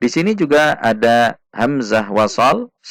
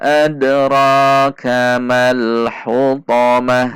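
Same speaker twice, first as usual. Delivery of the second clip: slow, measured recitation with held long vowels; Quran recitation demo; 3 semitones higher, much slower than usual. Shown as a demonstration. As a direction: recite evenly, drawing out each long syllable. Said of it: "Mari kita baca sekali lagi".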